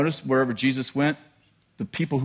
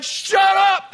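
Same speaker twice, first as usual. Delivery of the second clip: stewardess shouting at the top of her voice; high, loud yell to silence him; 5 dB louder than usual. Acted. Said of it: "they're yelling at each other like that".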